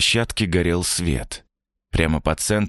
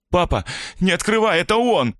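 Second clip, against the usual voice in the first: panicked shout; 13 semitones higher than usual. Acted. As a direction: scream the warning in terror. Terrified daughter warns father.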